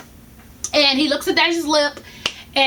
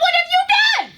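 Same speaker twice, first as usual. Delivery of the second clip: exasperated outburst; 13 semitones higher than usual. Retold or imitated. Exasperated at useless doctor.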